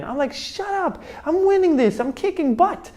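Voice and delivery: high, strained, stubborn retort; strained defiance. Retold or imitated. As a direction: push through it, refusing to quit.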